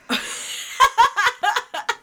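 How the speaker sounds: shrill burst of laughter; sudden high laugh; high shrieking laugh. Real, not acted. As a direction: let out a high cackle.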